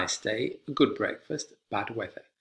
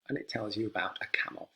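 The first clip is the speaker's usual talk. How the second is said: whisper; 2 semitones lower, 9 dB quieter, faster than usual. Acted. Playful aside in list.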